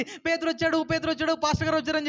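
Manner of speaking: put-on high, eager child announcing news; excited little girl. Girl runs to tell the elders, high and excited. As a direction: burst in like a thrilled child. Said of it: "పరిగెట్టుకుని అందరి దగ్గరకు వచ్చి చెప్తుంది".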